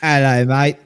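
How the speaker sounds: a hint of an Aussie greeting; light Aussie hello; Australian accent. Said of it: "it's like me talking now".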